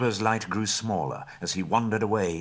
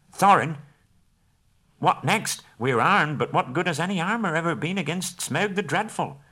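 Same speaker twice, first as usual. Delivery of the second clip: anxious question; 9 semitones higher than usual. Acted. Bilbo questions Thorin anxiously.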